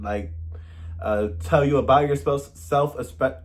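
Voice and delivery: ordinary delivery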